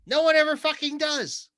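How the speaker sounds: loud, high exclamation; exasperated outburst. Real, not acted. Exasperated punchline to mocked reassurance.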